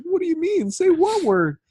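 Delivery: very high, baffled voice; confused wife. Retold or imitated. Wife confused by her husband's demand, very high. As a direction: squeak it out, totally lost. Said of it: "And she's like".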